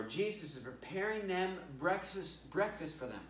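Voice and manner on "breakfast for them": ordinary delivery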